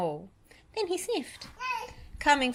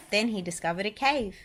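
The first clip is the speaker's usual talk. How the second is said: plain reading voice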